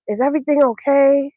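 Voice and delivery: high-pitched mock tearful question; imitated tearful teacher. Crying teacher asking her student if all is okay, imitated tearful voice. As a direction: mimic a teacher asking through tears. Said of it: "she just starts crying".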